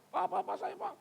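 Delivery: high, eager overlapping calls; clamouring pupils. Acted. Pupils all calling the teacher at once, high clamour. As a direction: mimic pupils competing for attention.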